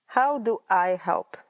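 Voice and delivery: normal talking voice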